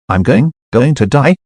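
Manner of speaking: ordinary delivery, nothing marked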